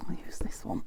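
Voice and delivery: soft unvoiced whisper to herself; whispered aside. Real, not acted. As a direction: whisper it under your breath. Whispering aside while working.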